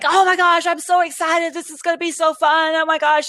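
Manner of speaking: high, breathless-eager gush in one run; mock an over-excited chatterer; imitating gushing excitement. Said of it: "he was just going on and on".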